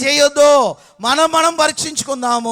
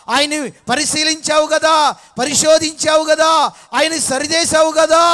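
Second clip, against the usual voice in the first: raised, impassioned prayer voice; fervent preaching; 5 semitones higher, 4 dB louder than usual. Acted. Preacher voicing a prayer to God, fervent and raised. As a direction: call it out with fervour.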